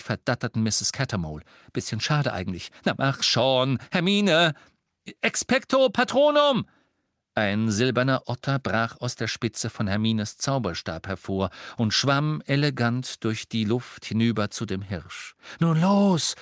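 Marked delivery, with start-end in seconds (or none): 2.8-4.5 higher, rising insistence; impatient urging. Acted. Harry urges Hermione on. 5.2-6.7 high, loud spell shout; spell incantation. Acted. Spell cast in full voice. 15.6-16.3 loud, higher call to move; urgent command. Acted. Harry orders them to move.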